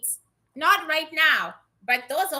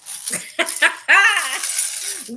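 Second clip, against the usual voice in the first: laughing; 4 semitones higher, 4 dB louder than usual. Real, not acted. Laughs at spirit's answer.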